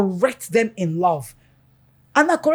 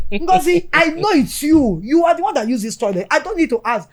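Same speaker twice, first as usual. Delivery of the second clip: accusing parent; 5 semitones higher than usual. Retold or imitated. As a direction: point the finger at the child, sure of guilt. And you're like.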